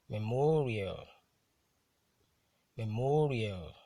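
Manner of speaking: "memorial" with MO hit harder; careful model reading of "memorial", stressed on MO; penultimate stress. Shown as a demonstration. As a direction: swell on MO in "memorial", then fall. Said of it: "stressed on the penultimate syllable".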